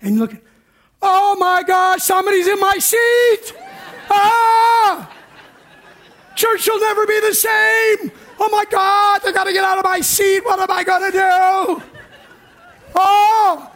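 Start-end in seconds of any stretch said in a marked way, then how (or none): 1.0-11.8 high, loud panicked squeal; hammed-up shrieking panic over a taken pew; mock panic. Acting a panicked churchgoer. 13.0-13.7 high loud Oh of alarm; hammed-up shocked Oh; mock gasp. Panic exclamation.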